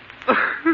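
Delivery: a short, very high, cracked laugh; unhinged laugh. Acted. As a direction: let out a mad giggle.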